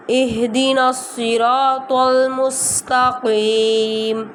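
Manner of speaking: the ain in نَسْتَعِينُ voiced from the throat; clear ain sound. Shown as a demonstration. Teacher showing students the ain sound, slow recitation. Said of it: "آئین کی آواز نکالنی ہے".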